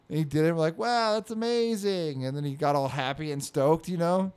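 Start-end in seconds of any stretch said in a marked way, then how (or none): 0.8-2.2 slow, higher mock admiration; put-on impressed grown-up; pretended amazement. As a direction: humour a child with fake wonder. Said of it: "just pretending what this kid".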